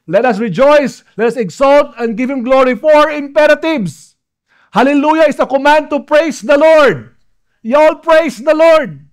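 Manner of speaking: high, loud, emphatic proclamation; triumphant pulpit exhortation; full-force preaching. Real, not acted. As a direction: drive each command home to the congregation.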